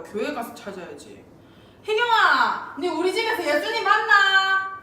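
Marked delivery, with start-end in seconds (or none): 1.9-4.7 calling out. Real, not acted. Mother calls daughter across room.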